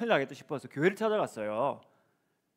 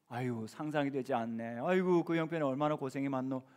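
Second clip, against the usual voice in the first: tender comfort; 5 semitones lower, 7 dB quieter than usual. Shown as a demonstration. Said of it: "이렇게 말씀을 해주시면 위로가 돼요".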